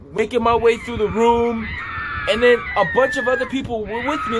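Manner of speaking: rising to a loud, fast, excited rush; urgent retelling. Real, not acted. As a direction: tell it like you're fleeing all over again. Frantic escape from tornado, retold.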